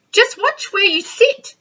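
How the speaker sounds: high squeaky nervous plea; squeaked. Acted. Tiny to Penelope on the bus, high squeak. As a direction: squeak it out, worried. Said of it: "squeaked Tiny".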